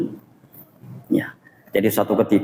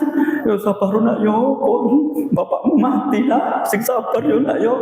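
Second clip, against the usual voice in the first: mock crying comfort; 6 semitones higher, slower than usual. Neighbour weeping while comforting an orphaned child, mock crying. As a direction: sob out the comfort as if weeping yourself. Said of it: "sambil menangis".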